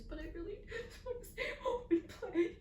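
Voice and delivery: put-on high, whining child voice, half laughing; whiny crying child. Grandson begging to stay home, whining and crying. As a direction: whimper it like a six-year-old near tears. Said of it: "And he goes".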